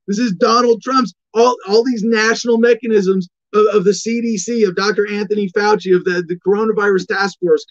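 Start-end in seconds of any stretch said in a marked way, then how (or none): none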